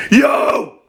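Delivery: high, loud screamed yo; mid scream. Shown as a demonstration. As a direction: belt a mid-range metal yo.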